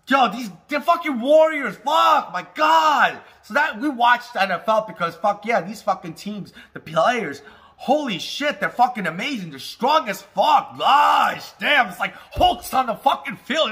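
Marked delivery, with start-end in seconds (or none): 0.1-3.2 loud, high, thrilled exclamation; excited shout. Real, not acted. Raving about players' strength. 7.8-13.7 loud, high, thrilled exclamation; excited shout. Real, not acted. Raving about players' strength.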